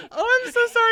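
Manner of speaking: high, loud embarrassed apology; mortified apology. Retold or imitated. Apologizing to the dog owner after baby talk, mortified. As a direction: blurt out a squeaky sorry.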